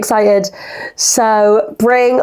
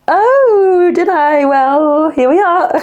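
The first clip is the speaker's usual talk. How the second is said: caught-out surprise; 9 semitones higher, much slower than usual. Retold or imitated. Replying to Lucy about the podcast, in high sheepish surprise. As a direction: squeak it out, caught red-handed. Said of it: "And I was like".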